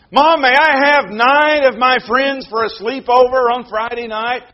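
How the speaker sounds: put-on pleading child. Child begging parent in sermon.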